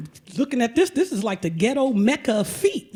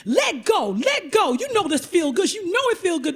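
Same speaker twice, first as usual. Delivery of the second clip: higher, insistent urging; mock coaxing; 7 semitones higher than usual. Pedicurist urging the client to let go, insistent. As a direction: coax her to give in.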